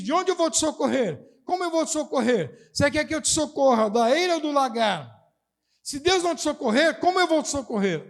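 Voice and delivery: high, mocking rhetorical questions from the king; ironic king. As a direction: throw the questions back at her with bitter irony. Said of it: "O rei tá fazendo uma pergunta pra ela irônica".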